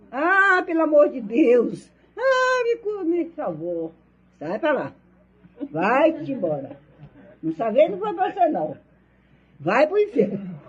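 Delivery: frantic screaming woman, retold; retold frantic yelling. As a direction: replay the woman's desperate shouting.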